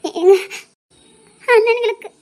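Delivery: loud tearful start; choked up, pleading with a husband; crying. Acted. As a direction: begin explaining through sobs. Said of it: "வேற எதுக்கும் அழுதா".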